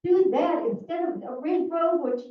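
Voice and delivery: loud, heated demand; angry. Real, not acted. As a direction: hammer the point home loudly. Heated public complaint.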